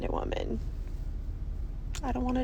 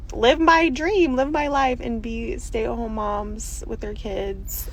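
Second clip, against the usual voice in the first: normal talking voice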